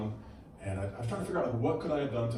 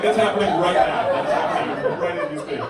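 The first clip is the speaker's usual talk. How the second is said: lightly voiced shocked audience member, louder; imitating an offended heckler; 6 semitones higher, 9 dB louder than usual. Comic voicing an audience member protesting a topical joke, louder. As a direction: play the crowd's protest, half mock. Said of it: "You go, like".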